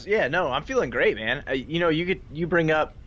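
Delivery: ordinary delivery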